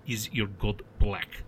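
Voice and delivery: put-on slow, pointed Arabic-accented question. A narrator's reading. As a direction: cut in with a slow, pointed challenge. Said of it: "Elotha interjected".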